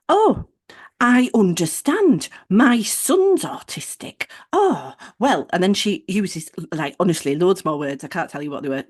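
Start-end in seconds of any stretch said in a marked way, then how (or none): 0.1-5.5 high, slow, gushing put-on sympathy; mock sympathetic receptionist. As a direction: imitate someone cooing loudly with over-the-top understanding. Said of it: "She says".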